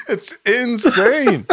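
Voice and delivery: helpless laughter through high-pitched words; real cracking up while insisting; laughing. Real, not acted. Cracking up over the retold clip.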